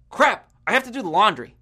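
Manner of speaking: mock grumble about a chore; begrudging. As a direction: act out mild, begrudging annoyance. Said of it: "usually in a begrudging manner".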